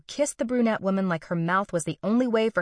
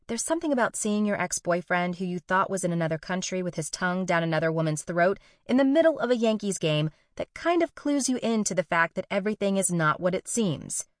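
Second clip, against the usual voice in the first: ordinary delivery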